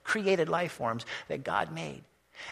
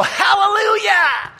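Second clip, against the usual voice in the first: high, loud, stretched hallelujah; joyful shout; 10 dB louder, slower than usual. Retold or imitated. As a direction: let the hallelujah ring out. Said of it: "they're gonna go".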